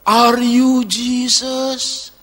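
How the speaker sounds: slow, wondering blind beggar; drawn-out, awed question; blind man's question. Retold or imitated. Blind candy seller asking the kind businessman, slow awe. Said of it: "Orangnya tanya apa?".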